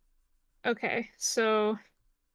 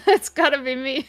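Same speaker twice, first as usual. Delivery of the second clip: said through a laugh; 3 semitones higher, 7 dB louder than usual. Real, not acted. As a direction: giggle through the line. Original line, laughing.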